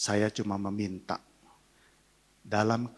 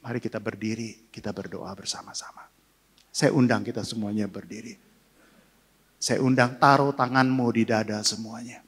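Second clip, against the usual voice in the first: worship leading; 3 dB louder than usual. Acted. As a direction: invite the church into prayer, pastor-style. Leads the congregation into prayer. (pendeta memimpin jemaat berdoa, berseru lalu melembut)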